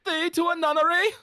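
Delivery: high, grand stage voice; Shakespearean declaiming. Pretend. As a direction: fling the line like a ham actor.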